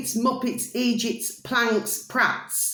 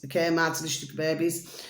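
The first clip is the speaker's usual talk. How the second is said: ordinary delivery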